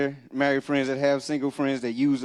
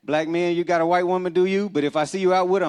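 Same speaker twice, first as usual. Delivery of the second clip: usual speaking voice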